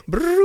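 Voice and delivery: a sudden high-pitched brrr, like a buzzer; high brrr noise. Retold or imitated. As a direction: buzz it off high and quick. Dismissive noise after quote.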